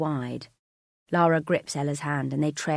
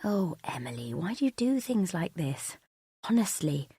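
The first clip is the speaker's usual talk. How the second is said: gentle sigh of a patient sister; calm, kind; 2 semitones higher than usual. Acted. Sister answering Emily down the phone, calm and kind. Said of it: "and it's calm, kind".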